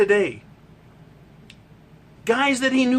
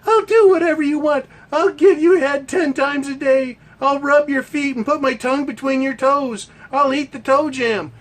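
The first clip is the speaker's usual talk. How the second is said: higher and louder, desperate pleading; hammed-up groveling plea; mock begging woman; 5 semitones higher, 3 dB louder than usual. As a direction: plead over the top like a groveling lover. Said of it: "a woman fucking begging".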